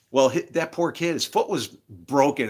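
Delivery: usual speaking voice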